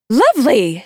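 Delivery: high, affected society trill; chirpy escort. A narrator's reading. Escort hosting the reaping ceremony, high and affected. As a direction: pitch it up like a perky hostess. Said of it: "says Effie Trinket".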